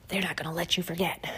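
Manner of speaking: breathy warning whisper, no voice; ominous whisper. Acted. Host warns listeners in a conspiratorial whisper. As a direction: whisper it like a dark secret.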